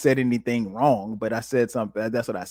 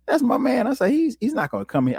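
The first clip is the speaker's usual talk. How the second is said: high, insistent "that's my man"; emphatic defense; 13 semitones higher than usual. Retold or imitated. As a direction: vouch for your boy with conviction. Defending friend to coworker.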